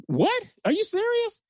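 high-pitched, disbelieving questions; incredulous surprise. Retold or imitated. Hotel manager hears a star is coming, incredulous. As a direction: react as if you can't believe it. Reacting to surprising phone call.

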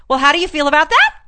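loud, high, exaggerated question; insistent question climbing high at the end; mock eager probing. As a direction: press for feedback, hammed up. Imitating women pressing silent men.

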